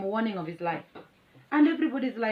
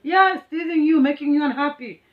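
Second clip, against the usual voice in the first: pupils calling teacher; 7 semitones higher, 5 dB louder than usual. Retold or imitated. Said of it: "So they're like".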